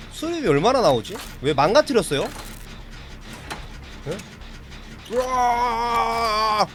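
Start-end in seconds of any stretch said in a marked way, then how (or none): 5.1-6.7 long drawn-out scream of fright; panicked scream. Real, not acted. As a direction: let out a long terrified yell. Chased in game, screams. (길게 질러대는 겁먹은 비명)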